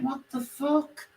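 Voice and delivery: retold disbelief. Caller retelling being threatened, slow mock disbelief. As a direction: stretch it high in mock shock. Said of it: "I'm like".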